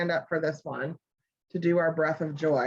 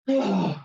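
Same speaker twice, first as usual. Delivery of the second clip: loud releasing sigh; big sigh; 3 dB louder than usual. Shown as a demonstration. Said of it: "Big old sigh".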